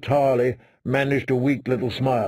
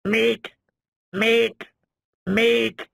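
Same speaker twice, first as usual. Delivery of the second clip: much higher, squawking raven cry; echoing raven; 9 semitones higher, much slower than usual. Acted. Mormont's raven on his shoulder echoing, squawking. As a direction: croak it like a bird. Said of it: "The raven ... echoed".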